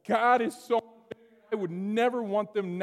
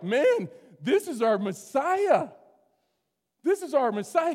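put-on excited kids praising; 7 semitones higher, 5 dB louder than usual. Preacher as temple kids cheering their Messiah, excited and loud. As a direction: imitate children bursting into praise.

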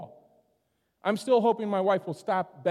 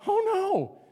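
high mock-panicked wail; panicking mom; 14 semitones higher than usual. Dad imitating mom missing her kids, high panic. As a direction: imitate mom's alarm in a high voice. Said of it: "the emotions of the mom going".

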